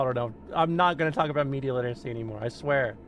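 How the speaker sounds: ordinary delivery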